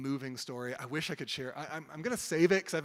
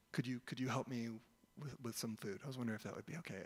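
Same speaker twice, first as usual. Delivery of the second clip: very quiet, hesitant request; hushed, halting retold plea; soft voice; 3 semitones lower, 10 dB quieter, faster than usual. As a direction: imitate a stranger shyly asking for help. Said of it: "this pretty soft voice".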